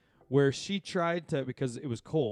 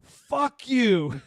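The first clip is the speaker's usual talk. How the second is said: high, loud spat curse; angry swear; 10 semitones higher, 7 dB louder than usual. Retold or imitated. Coach cursing at the crowd, high and loud. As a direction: fling it at the crowd. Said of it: "turns to the crowd and goes".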